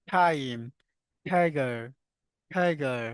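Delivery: টাইম and টাইগার said with a breathy ঠ; aspirated t. Shown as a demonstration. Teacher drilling students on aspirated ঠ in English words. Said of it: "টিতে আমরা always কি পাই? ঠ পাই".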